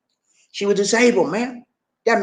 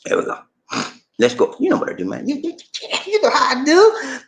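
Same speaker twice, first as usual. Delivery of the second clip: ordinary delivery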